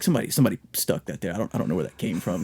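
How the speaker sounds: low, soft mumbler with repeated starts; lightly voiced cartoon oddball; cartoon-character impression. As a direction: imitate a hesitant Family Guy type. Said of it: "He sounds like a Family Guy character".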